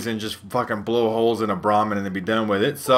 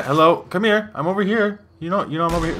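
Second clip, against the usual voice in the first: higher-pitched taunting call to an enemy; calling out to someone; 7 semitones higher than usual. Pretend. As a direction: call him over, pitched up.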